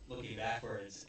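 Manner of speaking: ordinary delivery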